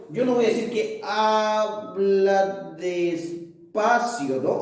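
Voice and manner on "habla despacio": "habla despacio" stretched syllable by syllable; teacher demonstrating very slow speech; slow, drawn-out demo. Shown as a demonstration. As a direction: draw out each syllable to show slowness. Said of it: "hablando de alguna manera lentamente o poco a poco".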